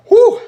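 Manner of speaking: shrill whoop from the burn; high loud pained whoop. Real, not acted. Burning mouth, whoop.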